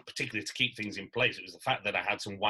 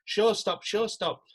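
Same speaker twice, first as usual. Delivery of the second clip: manager's shout; 10 semitones higher, slower than usual. Retold or imitated. Company manager calling the cast off stage, urgent shout. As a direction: call the stop like a company manager. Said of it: "the company manager shouted".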